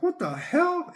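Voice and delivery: high mock-shocked what the hell; startled high outburst. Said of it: "and I was like".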